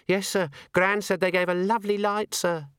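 put-on high young maid voice.

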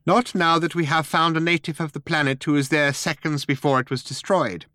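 high little mouse voice; officious mouse. A narrator's reading.

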